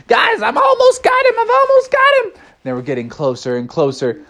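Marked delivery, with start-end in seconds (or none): 0.1-2.3 very high excited voice; squeaky cub. Acted. Cub driver nearly catches the garbage truck, high squeaky excitement. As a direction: squeal it to the others. Junior Bear chasing the truck.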